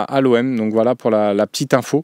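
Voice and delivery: usual speaking voice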